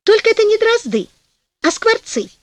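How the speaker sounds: высокий голос жены, поправляющей мужа; возрази мужу высоким голосом; возражение жены. A narrator's reading. Жена возражает мужу в сказке. (с возражением)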